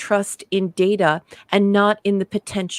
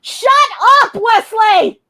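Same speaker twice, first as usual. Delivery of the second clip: high, loud full-voiced shout; yelling all caps; 7 dB louder than usual. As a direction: yell each word like capital letters. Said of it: "in yelling all caps".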